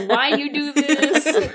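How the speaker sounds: slow, high question through laughter; hammed-up plaintive why; playful bewilderment. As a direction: stretch it out in mock dismay, laughing. Second joking baffled question.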